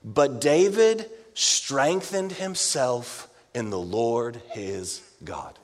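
slow, low, deliberate scripture read; slow weighty verse reading. Shown as a demonstration. Preacher reading the key verse, slow and weighty. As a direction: land each word slowly and gravely.

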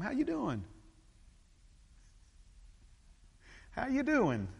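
high-pitched singsong greeting; friendly greeter. Acted. People greeting you all the time, high singsong question. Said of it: "people ask you all the time".

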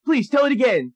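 very high, loud, fast gushing; excited. Retold or imitated. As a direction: gush like a hyped teen. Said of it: "Jonah Hill goes".

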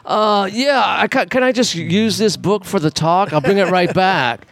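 high, lightly voiced caught-out guy; sheepish excuse. Friend caught stealing a book answers the manager, high and sheepish. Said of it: "He goes".

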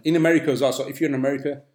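ordinary delivery

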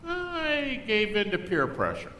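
a hint of a guilty admission; mock confession. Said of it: "Christians will often start out and say".

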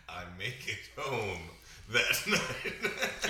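repeats the line through laughter; echoing his friend while laughing. Real, not acted. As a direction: laugh it back, amused. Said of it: "S2 echoes while laughing".

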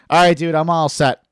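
imitated teen bailing out loudly; retold brush-off. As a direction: cut the priest off decisively. Retold boy cutting the priest off.

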